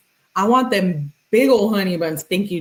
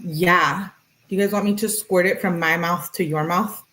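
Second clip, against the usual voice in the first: ordinary talk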